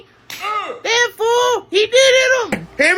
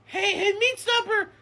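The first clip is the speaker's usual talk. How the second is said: ordinary talk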